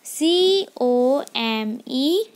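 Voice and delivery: each letter spaced out slowly; slow dictation of letters; slow spelling. Shown as a demonstration. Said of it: "teacher spells word for class".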